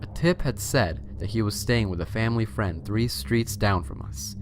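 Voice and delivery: plain reading voice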